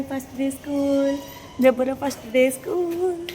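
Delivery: slow, stretched-out announcement; drawn-out announcement. Real, not acted. Parent announcing a child's first day of school, slow and drawn out. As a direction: draw out the last word as you announce it.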